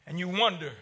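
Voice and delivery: ordinary talk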